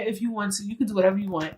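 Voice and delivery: ordinary talk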